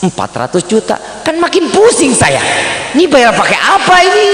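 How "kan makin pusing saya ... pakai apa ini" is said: high, mock-panicked protest; comic exasperation. Penniless man facing an expensive Fortuner, comic exasperation. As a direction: wail it high in mock despair. Broke man shown a costly car.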